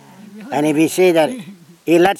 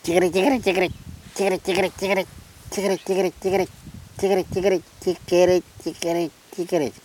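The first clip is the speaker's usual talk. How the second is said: higher, lighter chattering bird; willy wagtail call; 4 semitones higher, 5 dB quieter, faster than usual. Retold or imitated. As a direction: repeat it in little chirped triplets, higher and softer. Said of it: "That's a chicory ja".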